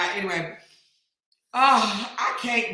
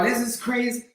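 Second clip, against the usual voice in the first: ordinary talk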